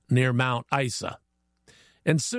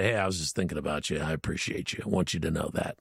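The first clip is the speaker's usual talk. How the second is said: much lower, softer, tender aside; gentle, sincere; 12 semitones lower, 5 dB quieter, faster than usual. Pretend. Host models a caring text to someone, gentle and low. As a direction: drop low and soft, quick and reassuring.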